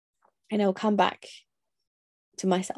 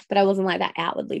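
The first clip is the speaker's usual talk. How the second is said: ordinary talk, nothing marked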